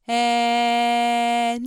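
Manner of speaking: えー held loud and steady; sustained e. Shown as a demonstration. Voice teacher demonstrating an open e. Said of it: "えを発音してみる".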